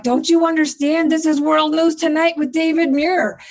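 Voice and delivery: frantic, trying to make them get it; exasperated excitement. Retold or imitated. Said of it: "I just wanted to choke 'em".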